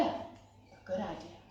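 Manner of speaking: hushed, slow, breathy aside; whispered. A narrator's reading. Narrator whispers the idea to the children, hushed. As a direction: whisper it like a secret.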